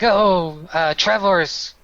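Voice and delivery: a hint of a jovial fisherman; lightly voiced local. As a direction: greet the strangers with a lift. Local greets party, voiced.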